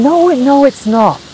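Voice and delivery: high-pitched retold denial; imitating the monk's insistent reply; mock gentle correction. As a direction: wave the objection away, acted out. Acting out the monk's reassuring denial.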